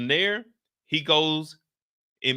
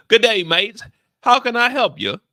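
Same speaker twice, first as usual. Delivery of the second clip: loud, high British try that drifts Irish; hammed-up British, slipping toward Irish; loud hammed-up British accent, half Irish; mock British accent; 6 semitones higher, 9 dB louder than usual. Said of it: "sounds like it's about to be Irish".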